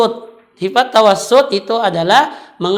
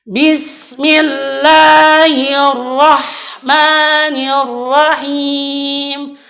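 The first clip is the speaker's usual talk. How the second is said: slow melodic Quran recitation; correct recitation; 4 semitones higher, much slower than usual. Shown as a demonstration. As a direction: chant it slowly and clearly. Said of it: "Sekali lagi". (bacaan tartil yang benar)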